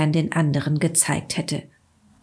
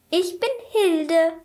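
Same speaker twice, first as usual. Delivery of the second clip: much higher snow woman voice, slow; chattering melting snowman voice; 11 semitones higher than usual. A narrator's reading. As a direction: introduce yourself slowly as the snowwoman. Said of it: "sagte der Schneemann".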